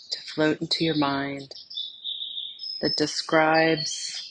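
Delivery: soft, unhurried meditation voice with long pauses; guided meditation. Acted. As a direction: gently lead them inward, phrase by phrase, leaving silence between. Leads a guided meditation exercise.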